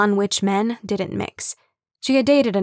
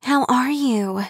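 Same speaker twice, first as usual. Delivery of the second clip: breathless; 4 semitones higher, slower than usual. Acted. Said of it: "Kim asked breathlessly".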